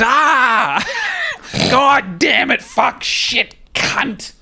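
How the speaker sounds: high shouted swearing fit, imitating; furious outburst. Foreman Wayno losing it over lunch, high mock rage. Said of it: "he fucking loses it".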